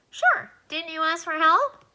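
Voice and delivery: high, squeaky little alien telling him to stop; a tiny voice fussing at a flood; squeaky alien protesting. Acted. Alien tells crying Alan to stop, high and squeaky.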